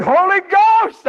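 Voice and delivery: high, drawn-out loud cry; excited shout. Retold or imitated. Preacher thanking the Spirit for a remembered name, excited shout. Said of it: "I shouted".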